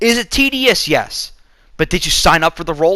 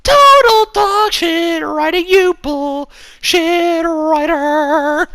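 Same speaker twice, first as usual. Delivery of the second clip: much higher and slow, drawn-out fury; exaggerated outraged rant; 12 semitones higher, much slower than usual. Real, not acted. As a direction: stretch every word in mock outrage at the writer. Ranting at the film's writer.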